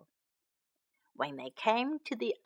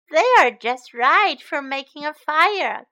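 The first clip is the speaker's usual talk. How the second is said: louder, higher excited exclamation; delighted cry; 4 semitones higher, 8 dB louder than usual. Acted. Joe admiring Betty's firewood, crying out excitedly. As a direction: cry out, pleased with the find. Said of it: "cried Joe".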